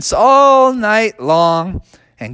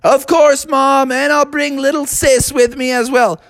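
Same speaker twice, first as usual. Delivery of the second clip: put-on higher-pitched agreeable kid; Froggy; 8 semitones higher than usual. Child promises to bring little sis, high agreeable voice. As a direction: answer mom brightly in a raised child voice. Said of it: "And Froggy said".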